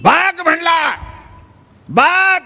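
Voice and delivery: high yelling call. Retold or imitated. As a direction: yell across the house for her. Husband shouting for wife.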